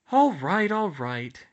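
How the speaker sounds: reluctant, loud sigh of surrender; groaning give-in. A narrator's reading. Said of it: "she groaned".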